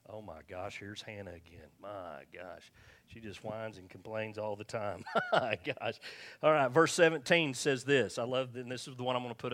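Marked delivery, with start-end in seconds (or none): 0.1-5.9 mock fed-up grumble under the breath; weary exasperation. God sees Hannah coming again, wearily exasperated.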